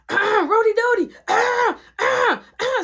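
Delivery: high, loud imitated signal with throat noises; attention-getting warning call. Friends warn him that Piper is there, high pointed calling. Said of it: "to get my attention".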